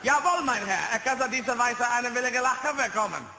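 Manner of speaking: loud, high cod-German gibberish; hammed-up German officer voice, loud and high; mock German. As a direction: bark fake German like a film officer.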